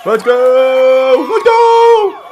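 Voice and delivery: high, drawn-out hype yell; cheering shout. Real, not acted. Viewer cheering on a kick attempt, high drawn-out shout. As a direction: holler it at full volume, stretching the vowel.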